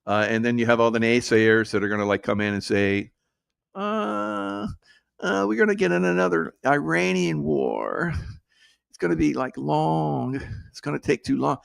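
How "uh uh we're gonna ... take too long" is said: mock whiny doubter, pitched higher; high mocking complaint; mocking naysayer. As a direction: sneer it like a worried naysayer. Naysayers mocked in a quote.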